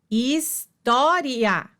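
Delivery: história stretched out, each syllable separate; slow syllable-by-syllable. Shown as a demonstration. Teacher showing students the syllables: história said slowly and split apart. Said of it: "teacher repeats word slowly".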